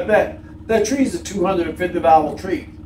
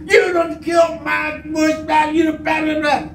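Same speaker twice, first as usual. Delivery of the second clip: angry neighbor yelling; 9 semitones higher than usual. Retold or imitated. Neighbor storms out yelling at the tree cutter, mock angry shout. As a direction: bellow it like a man whose bush got wrecked. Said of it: "The man come out".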